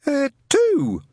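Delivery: high, polite, timid lad; nervous young man. Acted. Newton asking Shadwell about the ad, timid and high. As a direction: ask shyly, voice up.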